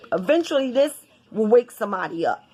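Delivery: normal talking voice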